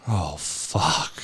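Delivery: quiet mock dismay. Retold friend's dismay.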